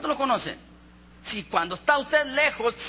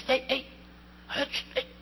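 soft nudging call; 8 dB quieter than usual. Acted. Mother trying to wake baby.

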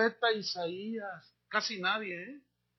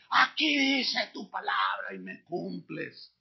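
mock demanding prayer, slow and shouted; rude demanding prayer; slower than usual. Preacher mocking a rude believer's prayer, slow mock shout. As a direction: imitate a believer ordering God around. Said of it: "Qué manera tan grosera".

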